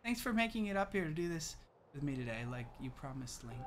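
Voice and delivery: very soft, gentle dialogue reading; hushed and light, as if confiding; soft princess character. Acted. Princess giving her sailcloth to the hero, soft and gentle. Reads Zelda's dialogue softly.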